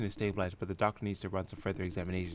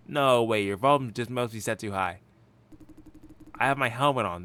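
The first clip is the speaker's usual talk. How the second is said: louder, insisting; loud denial; 2 semitones higher, 7 dB louder than usual. Acted. Helmeted man denying he is loud, still loud. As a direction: brush it off at full volume. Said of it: "he talks really loud".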